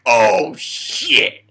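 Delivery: excited reaction. Retold or imitated. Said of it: "I'm like".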